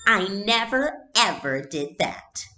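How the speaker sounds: high, loud, slow insistent denial; haughty little girl. A narrator's reading. Little sister insisting to her mother, high haughty voice. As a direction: stamp it out like an offended child. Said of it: "Kirsty said haughtily".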